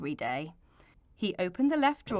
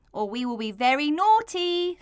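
put-on playful child threat, a bit louder; mock threat; 6 semitones higher, 5 dB louder than usual. George playfully threatening Mister Sniffer. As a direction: threaten like a cheeky kid. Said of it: "said George".

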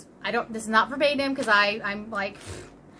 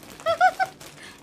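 high giggle. Real, not acted. Giddy over the gift.

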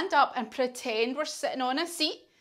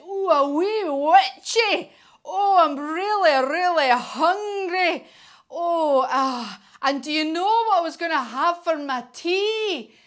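hungry dragon; 5 semitones higher, 5 dB louder than usual. Acted. Dragon tells the wee witch he is hungry, greedy relish. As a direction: play a storybook dragon savouring dinner.

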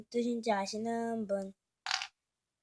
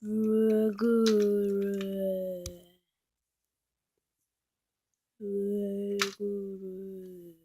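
eerie drawl; 4 semitones lower, much slower than usual. Acted. Eerie invisible voice teasing.

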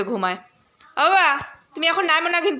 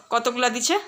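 normal talking voice

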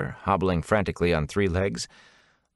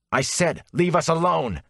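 raised, angry warning; angry shout; 10 semitones higher than usual. Acted. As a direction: snap it out as he whirls. Rand whirls to punch Pater.

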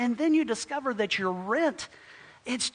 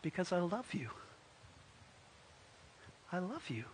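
very soft, sincere, with room to breathe; tender declaration; 3 semitones lower, 14 dB quieter than usual. Acted. Landlord answering why he would help, hushed loving reply. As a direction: confess love quietly and gently. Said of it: "To which he says".

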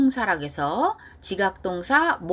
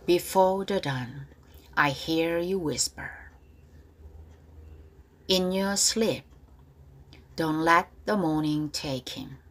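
slow repeat-after-me; much slower than usual. Shown as a demonstration. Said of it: "발음 다시 따라할게요".

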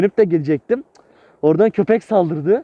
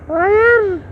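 surprised cry; 12 semitones higher than usual. Real, not acted. Stepping into water again.

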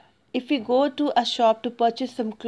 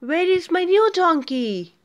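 higher, puzzled asking; the potter demands his new donkey; potter's surprised question; 6 semitones higher than usual. A narrator's reading. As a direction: ask in alarm for the donkey. Potter's question read aloud.

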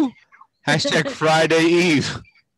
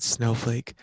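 creepy voice; 3 semitones lower, 7 dB quieter than usual. Pretend. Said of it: "Okay, creepy voice, all done".

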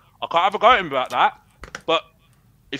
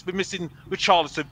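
ordinary delivery

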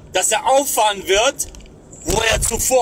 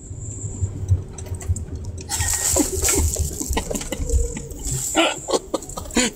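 breathy soft laugh; 6 semitones higher, 5 dB quieter than usual. Real, not acted. Laughs while calling for Doktor John.

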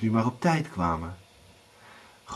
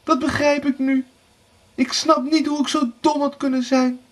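high, wailing self-reproach; tearful regret; 14 semitones higher than usual. A narrator's reading. Erika regrets going downstairs, sobbing. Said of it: "snikte Erika".

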